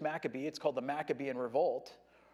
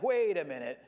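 ordinary talk

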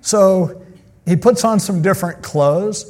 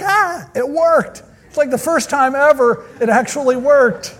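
mock shocked cry; 10 semitones higher, 5 dB louder than usual. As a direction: squeal like the scam finally worked. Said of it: "she cried out with a loud voice".

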